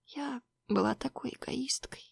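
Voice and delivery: whispered through tears; tearful whisper. Acted. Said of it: "прошептала сквозь слёзы".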